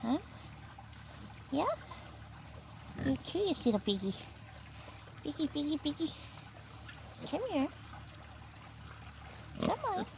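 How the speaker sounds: high, soft piggy-calling voice; coaxing piglets. Real, not acted. Farmer coaxes curious baby piglets closer, high soft cooing. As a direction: coo gently to lure the piglets.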